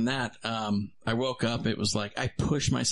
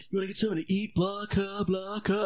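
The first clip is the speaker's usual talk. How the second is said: higher, casual mimic of a friend; imitating Cameron; 7 semitones higher than usual. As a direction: toss off his offer in a lightly higher voice. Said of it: "he's like".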